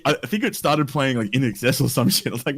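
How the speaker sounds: ordinary talk, nothing marked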